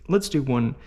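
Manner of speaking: normal talking voice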